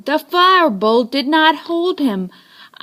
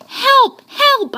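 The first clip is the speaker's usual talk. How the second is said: much higher, louder cries; panicked cry for help; 13 semitones higher, 4 dB louder than usual. A narrator's reading. Boy on the phone cries to the policeman for help.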